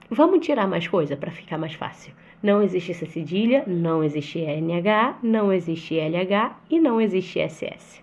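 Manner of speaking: normal talking voice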